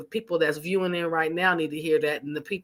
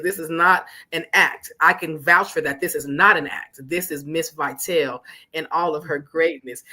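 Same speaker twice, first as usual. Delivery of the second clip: normal talking voice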